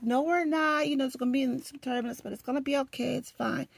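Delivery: high, soft, hushed reassurance; imitating a calming neighbor; soothing seatmate. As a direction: gently talk a panicked flyer down. Retold seatmate calms her panic.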